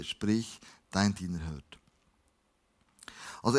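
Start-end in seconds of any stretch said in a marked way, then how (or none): 0.0-1.8 much lower and softer; hushed reply. A narrator's reading. Young Samuel answers Jahwe in the night, hushed reply. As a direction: answer humbly, almost under the breath. Boy's humble answer to God's call.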